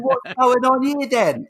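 put-on high, drawn-out Cockney kid; slow, piping Cockney child's question; exaggerated little Cockney voice. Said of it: "I had a Cockney accent".

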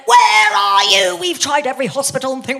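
mock-frantic parental shout; frantic parents. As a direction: call out like a panicked mother.

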